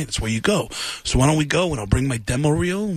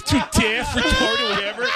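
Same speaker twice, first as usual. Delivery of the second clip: high, careless dude voice; drummer voice. Retold or imitated. Drummer brushing off the correction, high dude voice. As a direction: blow it off like a clueless rocker. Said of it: "And he goes".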